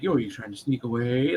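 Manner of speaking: low, sly imitation of the dragon; mock the dragon's creepy purr; luck dragon voice. Mimicking Valcor catching the boy sneaking away, low and sly. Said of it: "the way his voice goes the whole time".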